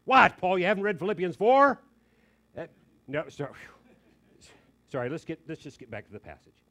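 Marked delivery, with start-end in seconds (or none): 0.1-1.8 sudden loud, high mock indignation; mock outrage. Preacher teasing Paul about his anxiety, loud mock scolding. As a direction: blurt it like catching Paul out.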